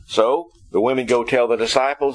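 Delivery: normal talking voice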